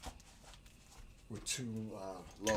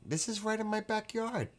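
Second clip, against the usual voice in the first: mock alarm, pitched up high; alarmed exclaim; 12 semitones higher than usual. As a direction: squeak it out in mock fright. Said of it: "We were like".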